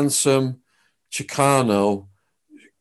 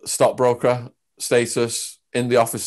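ordinary talk

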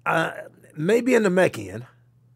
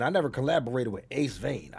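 normal talking voice

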